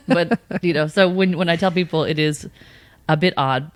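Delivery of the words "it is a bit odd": normal talking voice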